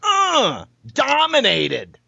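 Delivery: high strained drawn-out uh; silly noise. Acted. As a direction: stretch it into a goofy wail. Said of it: "you making that noise".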